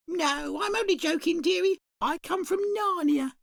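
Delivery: high, sing-song dearie voice; a chirpy shopkeeper greeting at the bell. Acted. Shopkeeper greets a customer, high and sing-song.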